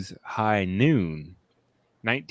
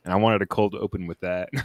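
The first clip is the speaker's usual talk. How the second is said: ordinary talk, nothing marked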